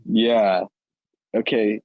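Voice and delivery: ordinary talk, nothing marked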